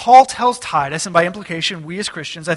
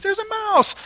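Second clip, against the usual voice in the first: put-on very high squealing child voice; mock kid squeal, pitched way up; kids' voices. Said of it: "And the kids".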